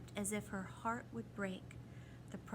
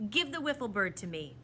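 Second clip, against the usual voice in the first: firm, louder command; snapped demand; 2 semitones higher, 7 dB louder than usual. A narrator's reading. The proc demands the bird from Tom, snapping. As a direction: snap the demand at him. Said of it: "the proc snapped".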